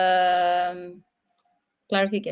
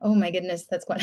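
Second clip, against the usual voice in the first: usual speaking voice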